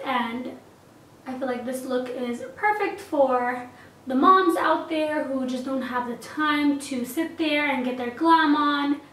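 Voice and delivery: usual speaking voice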